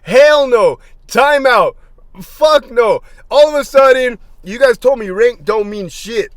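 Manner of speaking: loud, high outraged protest; indignant shouting. Retold or imitated. To the officers who chewed him out, a loud indignant yell. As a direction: shout them down in disbelief.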